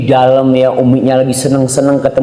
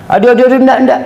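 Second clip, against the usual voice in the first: annoyed refusal; 9 semitones higher, faster than usual. Acted. Child shoving his parent's hand away, irritated.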